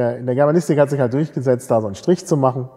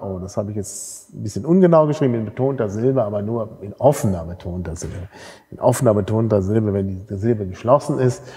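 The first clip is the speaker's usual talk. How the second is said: ordinary talk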